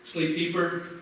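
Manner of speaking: slow soothing sleep command; hypnotic induction. Acted.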